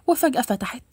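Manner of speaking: ordinary delivery, nothing marked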